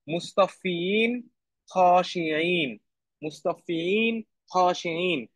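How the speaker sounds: "মুস্তাফিন, খাশিইন" stretched very slowly; teacher drawing out Arabic words for class to repeat; slow careful recitation. Shown as a demonstration. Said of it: "teacher models Quran words".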